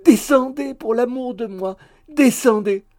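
loud, high-pitched plea; imploring. A narrator's reading. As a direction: beg her urgently from the street. Suitor implores her to come down. (supplication forte et aiguë)

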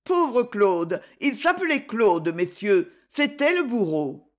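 plain reading voice

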